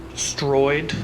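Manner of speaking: ordinary talk, nothing marked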